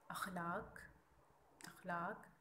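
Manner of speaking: अखलाक with an open a at the start; careful pronunciation. Shown as a demonstration. Teacher shows students the right vowel, slow and clear. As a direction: model अखलाक slowly, a-khlaaq. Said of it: "ये लफ्ज़ होता है अखलाक".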